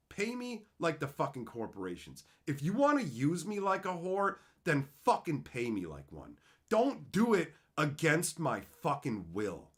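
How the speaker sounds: normal talking voice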